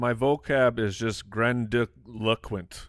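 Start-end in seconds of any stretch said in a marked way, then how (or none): 1.3-2.9 "grandiloquent" slowly, tripping over the syllables; stumbling over it. Real, not acted. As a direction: stumble through "grandiloquent" piece by piece. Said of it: "Definitely stumble over it".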